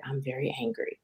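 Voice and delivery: a soft, very hushed admission; whispering. Retold or imitated. Confessing anger to the clerk in a whisper. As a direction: barely voice it, calm and low. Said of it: "And I started whispering".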